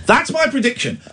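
ordinary talk

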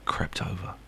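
hushed, creeping storyteller whisper; suspenseful whisper. Acted. Host whispering the cat chase for suspense. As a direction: build suspense in a near-silent whisper. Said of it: "Exciting, this".

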